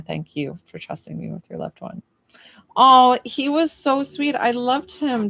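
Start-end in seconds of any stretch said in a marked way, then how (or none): 2.8-5.3 higher, louder and slow, full of affection; gushing fondness. Real, not acted. Medium gushing to Jessica about her late brother, fond. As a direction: melt over how sweet he was.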